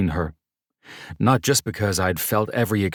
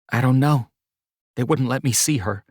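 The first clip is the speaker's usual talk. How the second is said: worried woman; 8 semitones higher, 4 dB louder than usual. Acted. Mercedes worried about grandma.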